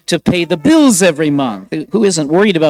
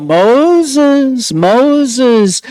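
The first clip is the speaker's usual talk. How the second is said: raised, higher calling of the name; God calling; 6 semitones higher, 3 dB louder than usual. Retold or imitated. God calls Moses from the burning bush, raised, higher call. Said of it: "God called".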